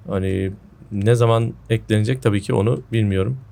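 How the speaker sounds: usual speaking voice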